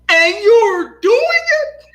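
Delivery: hammed-up disbelief at the son; laughing through a mock shout; imitating furious dad. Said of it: "and he said".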